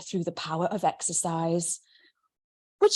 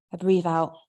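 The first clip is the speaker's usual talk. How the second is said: ordinary delivery, nothing marked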